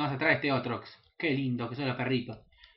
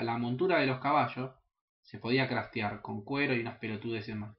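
ordinary delivery

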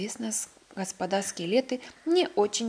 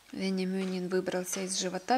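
ordinary delivery

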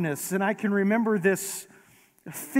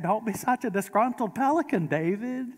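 put-on Moira Rose accent; 5 semitones higher than usual. Preacher redoing the TV mother's line in her odd accent. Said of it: "if you heard it in her accent".

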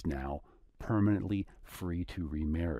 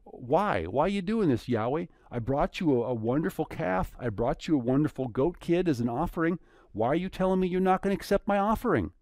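high, pleading protest, rushed at the end; indignant pleading; 9 semitones higher, 4 dB louder than usual. Acted. The offerers protesting to Yahweh, high and indignant. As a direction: whine up at God, why won't you take it. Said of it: "you say".

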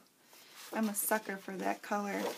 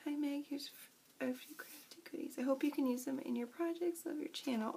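soft reading; 2 semitones higher, 7 dB quieter than usual. Real, not acted. Reads friend's card aloud.

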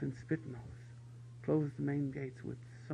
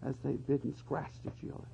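usual speaking voice

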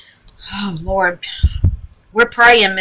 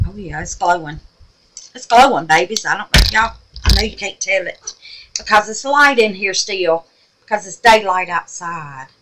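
ordinary talk, nothing marked

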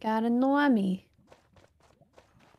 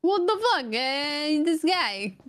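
much higher, indignant put-on voice; mock-offended accented character; 13 semitones higher than usual. Player pretending offence at accent teasing, high-pitched indignation. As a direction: protest like a character whose accent was mocked.

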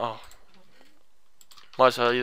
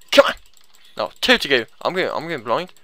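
ordinary delivery

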